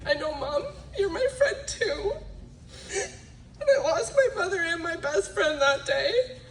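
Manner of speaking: high, tearful, half laughing through sobs; real tears breaking through; crying. Real, not acted. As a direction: voice breaking on the loss. Grief over losing mother.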